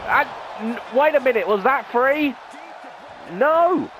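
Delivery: rising to a high shout of disbelief; hyped wrestling commentator yelling; excited shout. Real, not acted. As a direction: call the near fall as it happens. Near fall in a live match.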